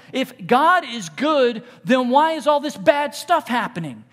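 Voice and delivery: slow, loud, pleading question; anguished plea. Acted. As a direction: cry out the question to heaven. Voicing anguished question to God.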